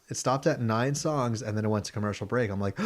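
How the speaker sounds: normal talking voice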